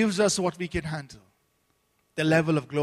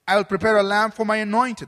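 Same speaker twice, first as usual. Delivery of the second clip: solemn scripture reading; 3 semitones higher, 7 dB louder than usual. Acted. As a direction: proclaim each verse a little louder, like a preacher reading Psalm. Reads scripture aloud.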